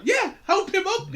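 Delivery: laughing mock crowd voice; mock line through laughter. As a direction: squeal it out, half laughing. Mocking the crowd's reaction.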